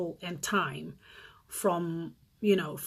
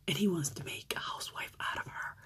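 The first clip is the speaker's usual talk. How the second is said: hushed, breathless whisper; 10 dB quieter than usual. Real, not acted. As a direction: lean in and whisper the shock. Shocked aside to viewers.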